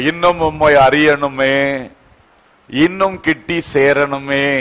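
low, soft, yearning prayer voice; hushed prayer. Real, not acted. Congregation telling the Lord they long for closeness, hushed prayer. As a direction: let it sink into quiet supplication.

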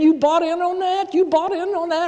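high squeaky disbelief; incredulous. Acted. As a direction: push the question up in disbelief. Incredulous challenge to crowd.

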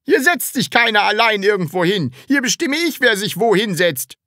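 high, fast, commanding schoolmaster; bossy strict teacher. Acted. Teacher ordering a new pupil about, strict and domineering. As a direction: lay down the law to the class.

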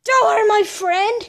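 loud protective yell; shouting defense. Acted. Kid yelling to protect his friend, loud. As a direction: yell it to stop them hurting him.

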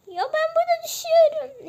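high, loud squeaky pet cry; panicked pet. Pretend. Pet cries out to owner while falling, high and loud.

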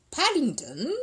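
high, incredulous echo; disbelieving. Acted. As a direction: echo the name as a doubting question. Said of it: "echoed Mister Briggs disbelievingly".

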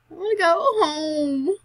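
loud, high, drawn-out homesick whine; whiny wail. Pretend. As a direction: wail it slowly like a fed-up kid. Player whines, fed up with desert.

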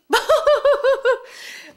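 big high laugh bursting out; burst of laughter. Real, not acted. Daughter hearing her mother chose Tara, big high burst of laughter. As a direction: laugh out loud in disbelief. Reacting to the baby name Tara.

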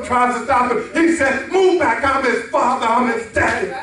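high-pitched, driving sermon delivery; impassioned preaching. Acted. Preacher builds the race story.

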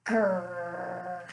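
low drawn-out growl; bear growl. Acted. Mysterious sound over the windowsill, low bear growl. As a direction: rumble it deep from the belly. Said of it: "Grrr rumbled from the bear's belly".

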